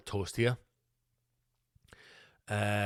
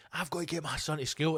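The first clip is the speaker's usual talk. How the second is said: ordinary talk, nothing marked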